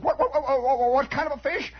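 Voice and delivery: high, rapid-fire disbelief; acted boy, amazed; stammering astonishment. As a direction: sputter the question up high. Boy asks again in astonishment.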